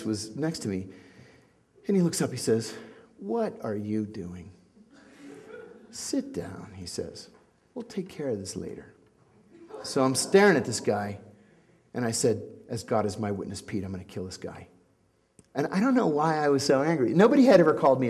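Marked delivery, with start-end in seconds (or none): 3.2-8.9 much softer, hushed, urgent; hushed warning. Retold or imitated. Pete quietly restrains him. 12.7-14.7 muttered threat. Retold or imitated.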